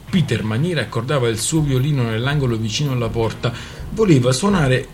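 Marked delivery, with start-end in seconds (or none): none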